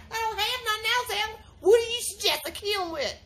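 ordinary talk

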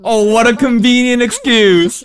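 high, loud, drawn-out mock retort; loud put-on incredulity; mock scepticism. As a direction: stretch it out slowly, voice pushed up. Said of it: "you're going, oh".

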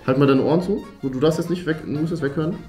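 usual speaking voice

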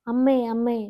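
calling out amme loudly; loud call for mother. Retold or imitated. Boy in the asylum calling loudly for his mother. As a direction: call out to mother across the ward. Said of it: "എന്ന് ഉറക്കെയുള്ള ഒരു വിളി".

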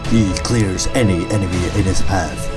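nature documentary voiceover; calm, grand narration; documentary narrator. Acted.

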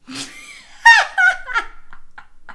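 high, loud burst of laughter; real laughing fit. Real, not acted. Reacting to a stranger's flirting, laughing fit.

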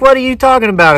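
high-pitched retold indignation; baffled protest. Winner told he won no raffle, baffled protest. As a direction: protest in mock disbelief, pitched right up.

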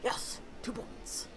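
slow, hushed little cheer; a quiet private win; whispered aside. Real, not acted. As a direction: whisper it to yourself, pleased. Player's aside while playing.

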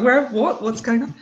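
confused. Retold or imitated. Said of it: "the guy was just like".

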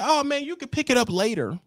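high, fast dispatcher; high eager dispatcher. Retold or imitated. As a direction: sell the early pickup. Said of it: "They tell you".

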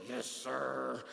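put-on low, soft, subdued teen reply; respectful son. Mike answering his fussing father, respectful and subdued. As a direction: answer dad low and obediently. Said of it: "one of the most respectful children".

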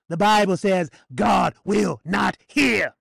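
slow, loud, word-by-word warning; forceful pulpit pronouncement; emphatic preaching. Real, not acted. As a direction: hammer out each word. Hammers a warning from scripture.

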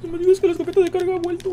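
very high comic falsetto squeal; squeaky falsetto. Pretend. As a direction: squeal it like a cartoon kid in disbelief. Repite en falsete agudo. (falsete chillón y exagerado)

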